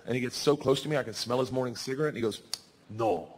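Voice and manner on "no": low cop voice, climbing at the end; hammed-up Russian policeman, dismissive. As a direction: start low and weighty, lift on the last words.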